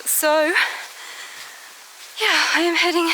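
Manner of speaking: breathless from the climb; panting, out of breath. Real, not acted. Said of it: "sorry, I'm out of breath".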